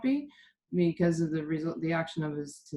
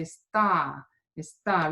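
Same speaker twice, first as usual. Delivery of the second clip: "está" with the punch on the final TÁ; stress on ending; 2 semitones lower, slower than usual. Shown as a demonstration. Teacher modelling the word for practice, está punched on the end. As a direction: hit the last syllable of está. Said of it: "really give that punch".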